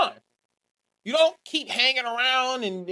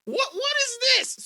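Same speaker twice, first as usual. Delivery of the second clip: rising to a much higher, disbelieving what is this; flabbergasted, getting higher on the repeat; baffled reaction; 10 semitones higher than usual. Retold or imitated. Watching Tsuji's promo, rising baffled disbelief. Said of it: "and I'm like".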